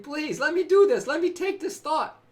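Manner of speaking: lightly voiced pleading presence; divine voice pleading. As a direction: beg gently but eagerly to take the thought. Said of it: "whispering sweet nothings".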